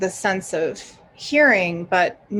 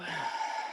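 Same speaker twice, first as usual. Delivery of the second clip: long breathy sigh out; cleansing breath; 13 dB quieter than usual. Shown as a demonstration. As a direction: let a deep breath go in an audible sigh.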